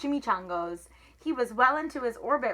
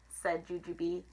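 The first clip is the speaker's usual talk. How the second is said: plain reading voice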